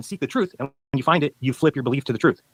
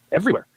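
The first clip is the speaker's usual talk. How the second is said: Everywhere. thrown out much higher in disbelief; emphatic everywhere; 8 semitones higher than usual. Real, not acted. Debater to flat earther, stressing Everywhere. in disbelief. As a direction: hit Everywhere. hard and high, incredulous. Repeats flat-map South Pole claim.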